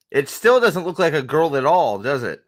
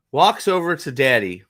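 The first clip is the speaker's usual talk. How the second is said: ordinary talk, nothing marked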